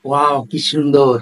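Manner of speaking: slow, drawn-out wow of admiration; lingering, put-on astonishment; demonstrated wonder. Shown as a demonstration. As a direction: stretch the words in staged amazement. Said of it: "বিস্ময় প্রকাশ করি".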